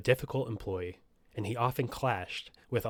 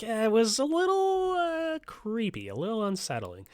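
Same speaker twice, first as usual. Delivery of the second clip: high, hesitant put-on voice; imagined reaction; 11 semitones higher than usual. Host voicing someone describing creepy interactions, high hesitant voice. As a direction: drag it out high, easing down at the end. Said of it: "You're like".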